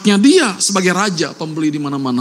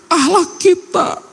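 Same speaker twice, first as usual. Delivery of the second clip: tearful; 8 semitones higher, slower than usual. Real, not acted. Listing deeds before God, weeping.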